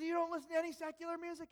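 put-on British accent, disbelieving. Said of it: "great British accent".

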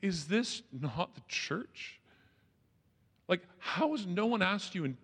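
slow, drawn-out disbelief; spelling out his disbelief to the youth pastor slowly; incredulous. Retold or imitated. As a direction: stretch it out, stunned. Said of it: "And I'm like".

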